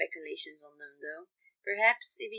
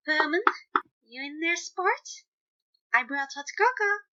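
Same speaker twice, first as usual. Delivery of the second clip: bright, higher, louder dad voice through a door; cheery fatherly call; 8 semitones higher, 6 dB louder than usual. A narrator's reading. Patton calling through the door to Roman, cheerful and fatherly.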